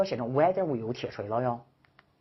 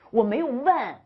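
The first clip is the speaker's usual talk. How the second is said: higher, louder insistence; emphatic insistence; 8 semitones higher, 6 dB louder than usual. Acted. Teacher acting out the sentence that asks nothing, insisting. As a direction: insist loudly that there is no question. Emphatic voicing of speaker's intent.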